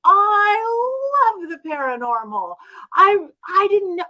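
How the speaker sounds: high, drawn-out gush on "love". Retold or imitated. Client revealing she loves the paranormal, gushing. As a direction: imitate a thrilled client, stretching the words.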